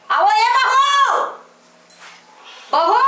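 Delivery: high, slow drawn-out call; long calling shout. Acted. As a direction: stretch the call across the house. Calling daughter-in-law to wake. (खींचकर ऊँची पुकार)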